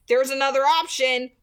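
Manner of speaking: ordinary delivery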